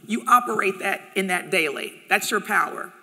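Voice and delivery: ordinary talk, nothing marked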